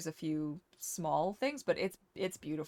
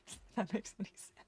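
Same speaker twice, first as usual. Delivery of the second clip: laughing; 12 dB quieter, faster than usual. Real, not acted.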